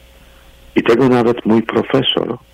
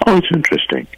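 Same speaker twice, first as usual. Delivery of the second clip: high-pitched "Oh", then dismissive politeness; wry, sarcastic; 9 semitones higher, faster than usual. Shown as a demonstration. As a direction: feign interest to put off the conversation. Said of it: "bardzo często mówione z przekąsem".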